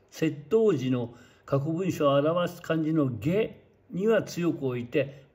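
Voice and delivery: ordinary talk, nothing marked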